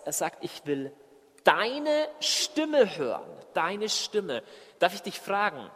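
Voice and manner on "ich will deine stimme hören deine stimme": pause, then stretch "deine" emphatically; stress on DEINE. Acted. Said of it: "Aber es ist mehr als das". ("deine" nach Pause hoch und sehr gedehnt)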